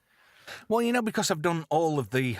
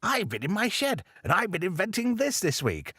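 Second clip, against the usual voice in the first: high eccentric inventor voice; mad professor voice; 5 semitones higher than usual. Retold or imitated. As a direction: play a dotty old mad scientist, pitched high. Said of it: "he looked like a mad professor type".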